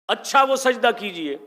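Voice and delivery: اچھا lifted high and loud; emphatic recitation. Shown as a demonstration. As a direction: declaim اچھا وہ سجدہ کیجئے. Verse repeated with emphasis.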